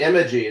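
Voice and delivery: "emoji" said as IM-uh-jee; short first vowel, weak middle in "emoji"; old mispronunciation. Shown as a demonstration. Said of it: "then my chat yelled at me".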